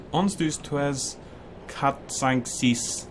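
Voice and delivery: counting "Ons, dos, tres" slow and soft, mixing Spanish and French; slow foreign counting. Shown as a demonstration. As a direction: fumble through numbers in a foreign tongue slowly. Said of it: "don't really know French either".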